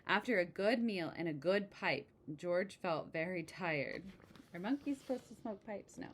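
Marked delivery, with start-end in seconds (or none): none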